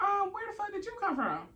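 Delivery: high-pitched, disbelieving squeal; shocked high-voiced where-did-you-come-from; incredulous outburst. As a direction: react like a stranger just walked in on you. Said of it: "So I'm like".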